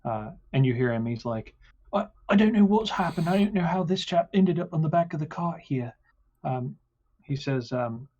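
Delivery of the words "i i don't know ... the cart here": high, fast, panicked driver voice; flustered cart driver. Acted. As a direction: babble it out, high and rushed.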